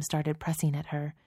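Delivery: ordinary delivery, nothing marked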